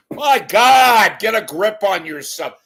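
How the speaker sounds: loud, high burst of exasperation; exasperated shout. Real, not acted. Host tells newscaster to get a grip, exasperated shout. Exasperated outburst after the impression.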